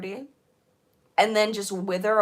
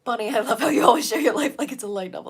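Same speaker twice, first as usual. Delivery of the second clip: reading chat in a voice; 2 semitones higher, 5 dB louder, faster than usual. Acted. Reading chat message aloud.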